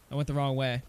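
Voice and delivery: ordinary delivery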